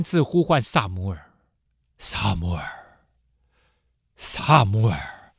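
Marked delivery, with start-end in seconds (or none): 2.0-5.3 slow, low whispered divine call; God whispering to the sleeping boy, slow and low. Acted. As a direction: breathe the name slowly, like a voice in the dark. God's third call.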